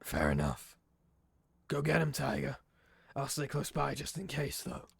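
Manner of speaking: lightly voiced gruff old detective; a hint of a cigar-smoking Nosferatu contact; gravelly D'Angelo. D'Angelo hauling the player into the alley, lightly gruff.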